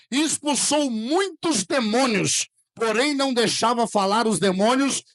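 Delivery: loud, slow, emphatic preaching; preaching at full force. Acted. Pastor reading scripture to the church, loud and slow. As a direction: proclaim the verse to the church.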